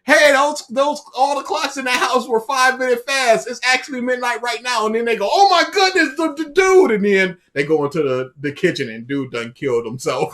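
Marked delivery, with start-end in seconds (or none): 0.0-4.9 loud, high hurried warning; urgent call. Retold or imitated. A caller warning the friends the clocks were fast, loud and urgent. 5.3-6.9 high, loud panicked cry; sudden alarm over their friend; panicked gasp. Retold or imitated. Friends realizing their friend is alone in the kitchen, high panicked cry.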